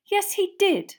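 high, loud defiant admission. A narrator's reading. Girl admitting her invitation to jealous friend, defiant. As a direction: throw it back high and loud. Hermione retorts, cheeks glowing.

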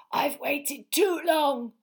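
slow, high, intense snarl; angry hiss. A narrator's reading. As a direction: force out each word slowly. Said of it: "he hissed".

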